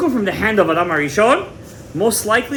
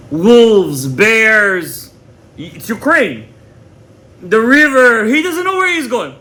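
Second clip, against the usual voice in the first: louder, rising dramatic build, higher by the end; dramatic storytelling; 3 semitones higher, 5 dB louder, much slower than usual. Retold or imitated. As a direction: paint the danger of the wild forest. Dramatic retelling of wild journey.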